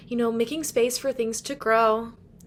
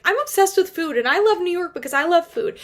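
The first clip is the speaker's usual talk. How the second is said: higher, louder mock gush; gushing Blaine; 7 semitones higher, 5 dB louder than usual. Blaine gushing about New York food, mock-giddy.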